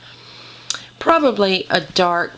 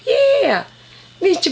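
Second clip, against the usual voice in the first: very high, bright yeah; delighted squeal; 11 semitones higher, 3 dB louder than usual. Real, not acted. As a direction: squeal with joy at the puppy. Puppy just peed on pad.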